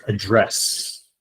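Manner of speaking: "address" stressed on the second syllable. Shown as a demonstration. Teacher showing pronunciation to students, stress on second syllable. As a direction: put the weight on the end of "address". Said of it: "the way I pronounced it".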